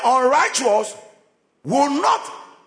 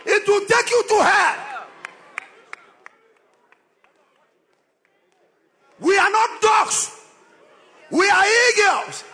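fiery preaching; 7 semitones higher than usual. Acted. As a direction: thunder at the sinners. Preacher climaxes loud and high.

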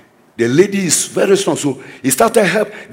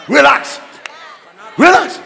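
rising shouted relax; 7 semitones higher than usual. Shown as a demonstration. Preacher shouting relax to the congregation.

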